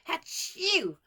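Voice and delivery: loud high exaggerated sneeze; big sneeze. A narrator's reading. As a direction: sneeze it out theatrically. Matron sneezes over orphans.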